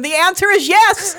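loud high yell; yelling from another room. Retold or imitated. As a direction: shout it from the next room. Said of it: "I yell from the other room".

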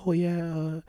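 lightly voiced, soft, kind old lady. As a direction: imitate her gentle, quiet teacher voice. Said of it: "she spoke real soft".

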